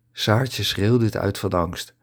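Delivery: ordinary delivery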